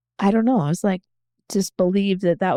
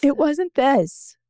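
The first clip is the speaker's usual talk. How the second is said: slow, higher, holding back tears; close to tears; 7 semitones higher, slower than usual. Real, not acted. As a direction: say it slowly, on the edge of crying. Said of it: "It's probably gonna make me cry again".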